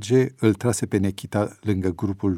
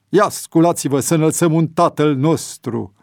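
loud, higher, rousing; rallying call; 6 semitones higher, 5 dB louder than usual. A narrator's reading. Priest calling the prisoners to their feet, rousing. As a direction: rouse everyone up to pray. Priest calls cell to prayer.

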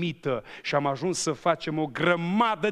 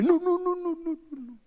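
shrill rapid mock protest, much higher; high squealing refusal. Preacher imitating the clerk refusing his extra money, high squeal.

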